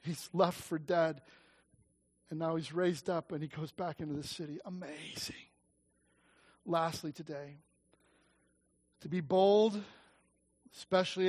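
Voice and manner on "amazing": breathed, much softer marvel; hushed wonder. Real, not acted. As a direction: let it fall into awe. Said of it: "hushed marvel".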